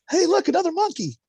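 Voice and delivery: high, loud cartoonish squeal of delight; a silly squeaky voice for the polymorph joke; goofy high voice. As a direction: pitch it way up like a cartoon character. Mock voiced polymorph quip.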